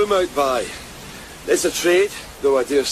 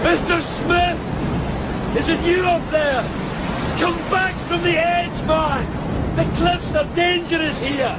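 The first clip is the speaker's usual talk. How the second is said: yelling against the gale; shouting over a storm; 12 semitones higher than usual. Acted. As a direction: call up to a man on a cliff edge. Calling to man on cliff in storm.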